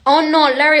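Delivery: ordinary delivery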